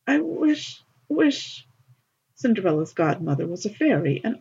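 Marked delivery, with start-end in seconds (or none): 0.1-1.6 high, broken little sob; a child's tearful, halting plea; sobbing. A narrator's reading. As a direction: choke the words out through tears. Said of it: "managed to sob out".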